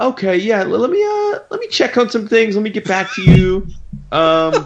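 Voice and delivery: high, put-on noncommittal voice; imitating a tightwad dodging the sale; mock cheapskate stalling. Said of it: "this is how it was gonna go".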